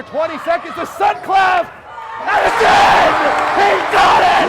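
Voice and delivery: rising, shouted play-by-play; excited shout. Real, not acted. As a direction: yell it as the ball drops in. Last-second shot, live call.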